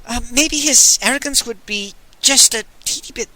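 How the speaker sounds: high, hesitant nervous plea; timid suggestion. Acted. Magnan timidly coaxing the Pope, high nervous voice. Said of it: "Magnan suggested".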